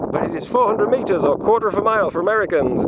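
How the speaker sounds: speaking up over the wind; shouting over wind. Real, not acted. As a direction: project it over wind noise. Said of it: "But you can't hear me over the wind".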